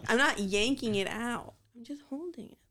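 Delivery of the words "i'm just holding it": whisper. Acted. Teasing banter about hair.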